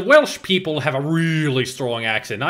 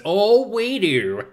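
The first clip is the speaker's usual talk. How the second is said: high, drawn-out mock quote; imitating Visu; 7 semitones higher, much slower than usual. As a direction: stretch it out, pitched up, as an imitation. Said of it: "Just like Visu says".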